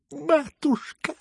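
high, drawn-out wail; loud high-pitched plea. A narrator's reading. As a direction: cry out in a thin, very high, slow voice. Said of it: "всё ещё вопил Лебедев".

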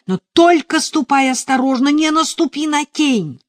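loud, high, commanding sorcerer voice; evil sorcerer. A narrator's reading. Sorcerer ordering her to circle against the sun, commanding. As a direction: ring it out as a wicked sorcerer's decree. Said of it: "говорит злой колдун".

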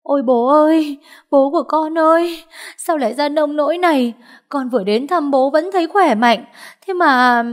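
high, loud theatrical lament; noisy, hammed-up crying lament; loud wailing. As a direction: wail it out at full volume. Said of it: "oang oang gào khóc".

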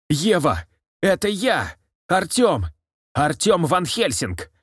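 high, pleading cry; desperate calling out. A narrator's reading. Artyom calls to Eva to recognise him, desperate cry. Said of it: "дрожащим голосом выкрикнул Артём".

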